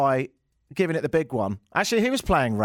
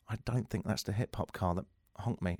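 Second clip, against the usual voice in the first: much softer sheepish realisation; sheepish aside; 6 semitones lower, 11 dB quieter than usual. Retold or imitated. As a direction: say it low, to yourself, dawning embarrassment. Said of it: "And I was like".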